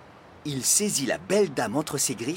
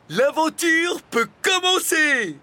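loud, high, drawn-out thrilled shout; delighted cry; 7 dB louder, slower than usual. A narrator's reading. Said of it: "s'écria le dragon ravi". (cri ravi, aigu et fort)

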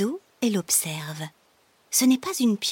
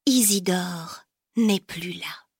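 ordinary delivery, nothing marked

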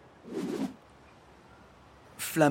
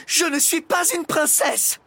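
high, loud exasperated protest; losing her temper; 5 dB louder than usual. A narrator's reading. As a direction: protest angrily, pitch way up. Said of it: "s'emporta la maîtresse". (protestation emportée, voix très haute)

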